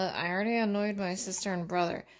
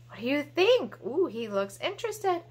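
ordinary talk, nothing marked

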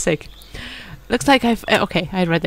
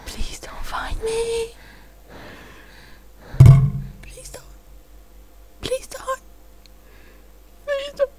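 whispering while hiding; 14 semitones higher, 6 dB quieter than usual. Real, not acted. Hiding from monster.

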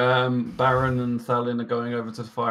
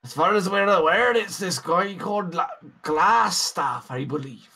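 put-on wobbly Scottish accent. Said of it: "what the fuck is going on with this accent".

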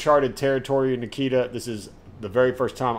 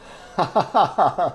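high burst of laughter; 6 semitones higher, 4 dB louder than usual. Real, not acted. After game shock.

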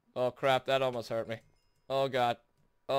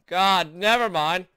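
ordinary talk, nothing marked

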